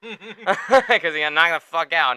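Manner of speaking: laughing repeat. Real, not acted. Host cracking up repeating Dustin's comeback, laughing. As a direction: savour the punchline, giggling.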